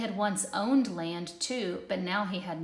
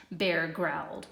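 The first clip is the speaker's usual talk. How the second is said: plain reading voice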